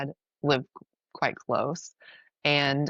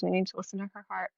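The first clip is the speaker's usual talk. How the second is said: put-on hushed toddler quote; 3 semitones higher, 7 dB quieter, much faster than usual. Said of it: "she shifts and she looks at the camera".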